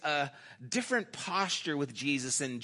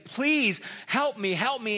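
higher-pitched imitated plea; begging; 6 semitones higher, 3 dB louder than usual. Woman begging Jesus for her child, retold as an urgent plea. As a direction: plead for help, raising the pitch. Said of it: "the woman who is begging Jesus".

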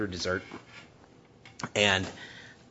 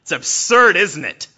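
much higher and loud, taunting; scoffing challenge; 11 semitones higher, 13 dB louder, slower than usual. Retold or imitated. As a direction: goad your brother, sneering. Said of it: "he's like".

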